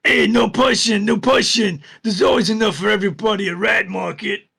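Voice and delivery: high, loud goblin yelling at the crowd; goblin crowd-wrangler. Acted. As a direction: shout over the pushing crowd like a goblin. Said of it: "a goblin stood at the front going".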